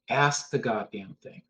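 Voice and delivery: ordinary talk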